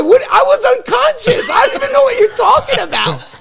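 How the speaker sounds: high, loud protest shouted through laughter; outraged shout. Retold or imitated. Son shouting his innocence at his angry dad, loud and high. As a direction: yell it, still baffled and indignant.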